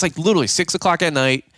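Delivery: plain reading voice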